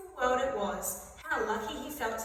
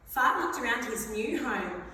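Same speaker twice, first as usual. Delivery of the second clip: ordinary delivery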